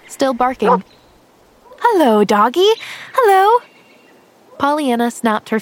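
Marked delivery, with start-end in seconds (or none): 1.8-3.6 high, loud call to a dog ahead. Acted. Girl calling out to an approaching dog, loud and high. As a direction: sing out a bright hello down the path.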